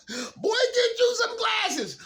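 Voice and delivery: very high, slow, cracking laughing yell; laughing mock shout. Teasing himself for needing glasses, laughing yell. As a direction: shout it through laughter at yourself. Mocking himself for bad eyesight.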